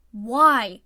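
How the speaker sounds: loud desperate why; pleading cry. Acted. Iwazumi pleading with his team, loud desperate cry. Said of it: "Iwazumi pleaded".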